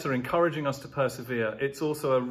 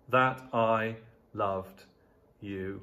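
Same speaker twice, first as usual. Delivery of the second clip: slow and measured, no extra weight on I; slow reading; 3 semitones lower, 3 dB quieter, much slower than usual. Shown as a demonstration. Preacher reading the verse to his church, slow and measured. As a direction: let each word land slowly. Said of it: "Literally, this phrase means".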